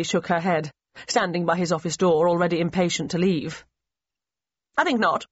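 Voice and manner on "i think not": firm, higher-pitched refusal; polite but firm no; firm reply. A narrator's reading. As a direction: turn it down firmly, starting high. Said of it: "she replied in a firm voice".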